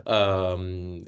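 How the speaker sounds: ordinary talk, nothing marked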